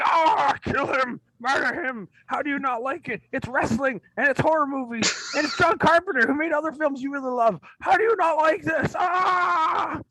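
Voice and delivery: high-pitched shrieking outrage, hammed up; mock outraged friends. A horror fan mocks friends outraged he dislikes They Live, high mock shriek. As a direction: squeal it like a scandalised fan.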